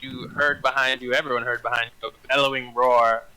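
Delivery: ordinary delivery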